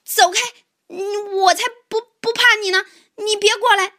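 high, loud, stammered defiance; frightened shouting. Acted. Mouse shouting at approaching tiger, scared and defiant. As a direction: yell bravely but stumble over the words. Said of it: "我冲他叫起来".

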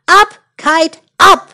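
loud, rising call to the kite; shouting. Acted. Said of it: "jump up and down and shout".

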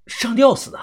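startled disbelief. Acted. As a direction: echo it back in surprise. Narrator's hero reacts in disbelief.

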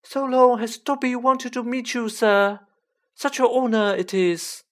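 very high, loud elf voice; high-pitched Dobby. A narrator's reading. Elf honored to meet Harry, high-pitched and loud. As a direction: squeak it out, loud enough to carry. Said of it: "in a high-pitched voice Harry was sure would carry".

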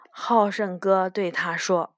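plain reading voice

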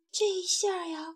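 plain reading voice

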